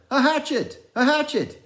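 high, loud, urgent cry; urgent shout. A narrator's reading. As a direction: cry out for the hatchet in panic. Urgent call for hatchet.

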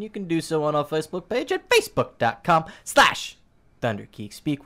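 ordinary talk

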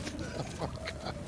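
giggling through the words; breathy laugh while telling them off; can't keep a straight face. Real, not acted. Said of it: "Why are you laughing at it then?".

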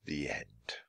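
very low, soft near-whisper; hushed closing. A narrator's reading. Narrator ends the book for listeners, hushed closing. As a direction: let it sink away as a sign-off.

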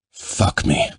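breathy unvoiced snarl. A narrator's reading. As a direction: snarl it with need. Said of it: "he snarled".

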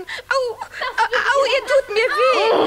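high pained yelp; pained cry. Acted. Little witch dragged away, crying out in pain. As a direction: cry out as they drag you.

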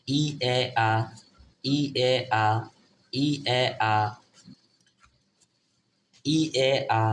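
repeated evenly, ই, এ, আ as separate vowel sounds; vowel sound drill. Shown as a demonstration. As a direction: drill ই, এ, আ in steady groups of three. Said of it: "ই এর উচ্চারণ".

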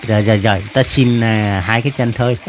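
low-pitched cartoon animal voice; bargaining creature. Animal asking for just two legs, low and conceding. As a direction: settle the deal down in a low voice.

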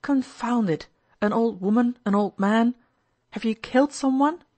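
jolted, demanding an answer; alarmed surprise. A narrator's reading. Startled friend exclaims in alarm.

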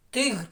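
Тигр said loud and clear as one syllable; stressed tiger word. Shown as a demonstration. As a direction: say Тигр firmly for the class to repeat. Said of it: "кажемо слово тигр".

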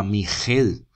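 Miguel as mi-JEL, throaty h sound; g as Spanish j. Shown as a demonstration. Teacher showing a misreading, Miguel as mi-JEL. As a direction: swap the hard g in Miguel for a jota. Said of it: "que no se diga Miguel".

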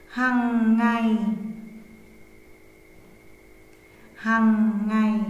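slow, word by word dictation; dictating. Shown as a demonstration. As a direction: read slowly for pupils to write. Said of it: "lắng nghe cô giáo đọc để chúng ta luyện viết".

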